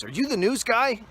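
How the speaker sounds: high, loud excited voice; rough guy quote. Retold or imitated. Rough guy asks the weatherman, high excited. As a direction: mimic the guy's eager question. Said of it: "he goes".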